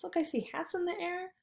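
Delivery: ordinary talk, nothing marked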